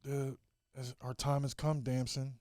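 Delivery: subdued breakup voice; Lori Harvey. Acted. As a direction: break it to him gently. Acts Lori's goodbye continuing.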